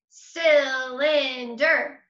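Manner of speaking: CYLINDER very slow, CYL-IN-DER; three separate beats. Shown as a demonstration. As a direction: punch out each syllable of Cylinder slowly. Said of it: "That's three beats or three parts".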